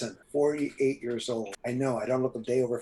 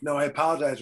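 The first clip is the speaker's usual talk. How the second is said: ordinary talk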